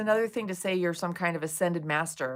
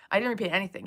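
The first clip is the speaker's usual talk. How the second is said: ordinary delivery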